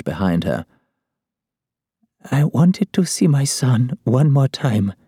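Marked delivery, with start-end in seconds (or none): 2.3-4.9 put-on high, slow, frail old woman; dying woman's sorrowful last wish; grieving old woman. A narrator's reading. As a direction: plead slowly in a high, frail voice.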